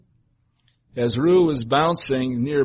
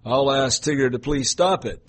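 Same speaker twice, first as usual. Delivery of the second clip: ordinary delivery, nothing marked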